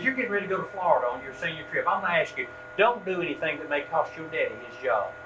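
put-on brisk, slightly hushed run through the old man's warning; quick retold warning. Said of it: "He said".

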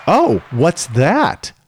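slow, drawn-out "Oh, what's that?"; mimicking the mom's casual question; drawn-out curious. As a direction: stretch it out, idly curious. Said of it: "The opening line is".

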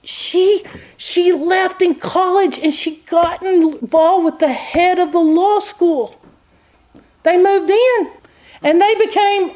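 high, eager gossip voice retelling the news; lawyer friend gossiping. Retold or imitated. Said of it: "she looks at me and she goes".

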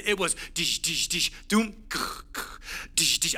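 mock dribbling noises. Preacher recalling his old weekend basketball practice, playfully imitating dribbles. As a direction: tap out the dribble like a ball on the court.

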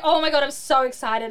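loud retold burst of delight; over the moon. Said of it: "over the moon".

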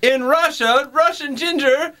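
put-on Russian accent. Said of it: "our Russian voice".

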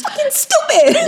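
high-pitched laughing scoff; laughing exasperation. Real, not acted. Host ranting about texters, laughing scoff. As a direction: laugh it out in disgust.

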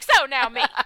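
loud, abrupt "me" turn; self-centred friend. Retold or imitated. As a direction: blurt it out loudly, grabbing attention. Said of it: "we'll be like".

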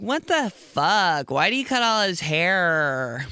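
put-on high, slow, drawn-out girl's gasp of horror; high, slow mock-horrified girl voice; girly shock. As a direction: play the shocked girlfriend. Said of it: "girls are like".

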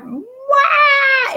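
a sudden high imitated crying wail; mock wail. Retelling breaking down in Home Depot, a high mock cry. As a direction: wail like a child bursting into tears. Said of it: "So I just was like".

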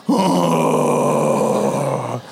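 low, weary mock relief; drained parent muttering praise; exasperated sigh. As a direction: sigh it out like a worn-out dad. Exasperated dad after son's rudeness.

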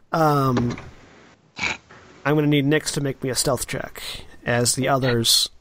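ordinary delivery

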